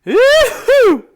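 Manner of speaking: high, loud whooping yell; wild yell. Acted. Shouting alarm in sketch.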